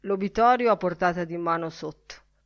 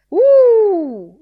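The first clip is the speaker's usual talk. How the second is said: very high, loud cattle call; drover's shout; 14 semitones higher, 18 dB louder than usual. A narrator's reading. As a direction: bellow it across the herd. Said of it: "gridò la voce del mandriano". (grido del mandriano, acuto e fortissimo)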